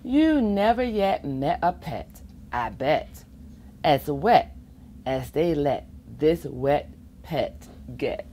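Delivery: ordinary delivery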